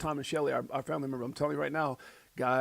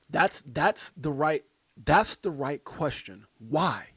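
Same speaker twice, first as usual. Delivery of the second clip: slow emphasis; 5 dB louder, slower than usual. Real, not acted.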